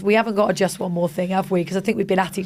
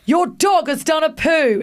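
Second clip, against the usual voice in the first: slow, higher disgusted shout; 7 semitones higher, slower than usual. Acted. Posh stranger shouting at dog walkers, disgusted. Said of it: "in a disgusted tone".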